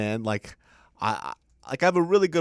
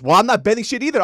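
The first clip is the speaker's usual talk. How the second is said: mock defiant retort; 10 semitones higher, 11 dB louder, faster than usual. Mom retorting in argument.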